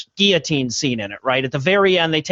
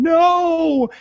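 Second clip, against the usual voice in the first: mock scream; 11 semitones higher than usual. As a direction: imitate a woman jumping up and screaming. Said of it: "standing up and screaming".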